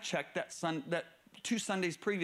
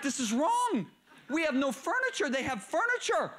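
higher, louder livid protest; livid wife; 8 semitones higher, 4 dB louder than usual. Retold or imitated. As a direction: voice the wife's outrage, pitched up. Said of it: "my wife was livid".

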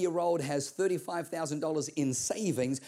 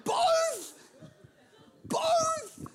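shocked outcry. Acted. Preacher reacting to God asking for both bills, high shocked cry. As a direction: cry out in disbelief.

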